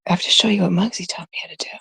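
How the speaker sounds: fast excited rush; hurried excited aside. Real, not acted. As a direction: blurt it out quickly. Rushing excitedly.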